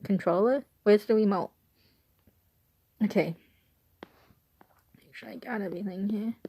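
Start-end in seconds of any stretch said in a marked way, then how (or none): 5.0-6.3 quick whispered check, fast and soft; whisper to self. Real, not acted. Girl whispering to herself while checking her filming notes. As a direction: murmur it under your breath, checking your notes.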